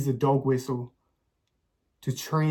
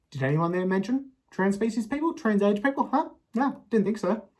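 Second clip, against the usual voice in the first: mock indignation; 8 semitones higher than usual.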